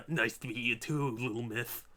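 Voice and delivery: low, soft smoker's voice. Acted. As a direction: lightly rasp it like a smoker. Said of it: "like, a smoker voice".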